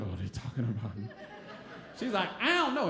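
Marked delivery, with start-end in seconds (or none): 0.0-1.1 low, soft muttering to himself. Retold or imitated. Hooded boy overhears the others, low hushed muttering. 2.4-2.9 high-pitched mock sass; Tracy. Girl answering onlookers about the stranger, mildly aggressive imitation. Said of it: "Tracy was always real aggressive".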